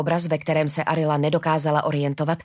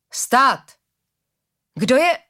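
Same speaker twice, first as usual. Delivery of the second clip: loud, high challenge; calling out; 6 semitones higher, 5 dB louder, much slower than usual. A narrator's reading. Tall woman challenges newcomers at the bridge, calling out. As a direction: halt them with a sharp raised voice. Guard challenges arriving travellers.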